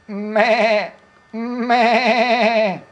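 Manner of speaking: put-on a bleating sheep call for a toddler. Shown as a demonstration. How does a sheep go?.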